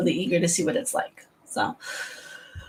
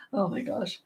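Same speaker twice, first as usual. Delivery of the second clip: ordinary talk, nothing marked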